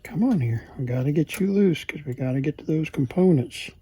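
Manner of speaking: usual speaking voice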